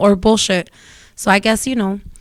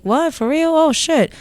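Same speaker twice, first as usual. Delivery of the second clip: surprised; 7 semitones higher than usual. Retold or imitated. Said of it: "and I'm like".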